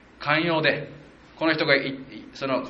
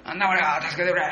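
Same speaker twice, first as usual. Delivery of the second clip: a mild mock panic, voice raised; panicked cry for help; 5 semitones higher, faster than usual. People in the burning camp, mildly mock panicked. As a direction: cry out for help like a frightened crowd. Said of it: "モーセに向かってわめいたんですよ".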